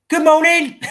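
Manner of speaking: high bright mock hello to a stranger; overly cheerful good morning; cheery greeting. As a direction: chirp it out to a passer-by. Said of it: "looking people in the eye and say".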